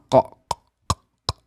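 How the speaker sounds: "Qa, qa, qa" whispered from deep in the back of the throat; voiceless "qa" caught far back in the throat. Shown as a demonstration. Teacher shows students the qaf sound, voiceless throaty "qa". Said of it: "In the back of the throat".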